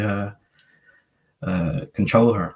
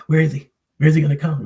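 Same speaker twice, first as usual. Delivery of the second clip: dog looking for owner; 7 semitones higher, 6 dB louder, much faster than usual. Retold or imitated. Voicing his dog searching again.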